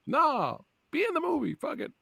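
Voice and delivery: mock local. Podcast host mocking a local, high and soft. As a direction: lampoon a townsperson, pitch way up.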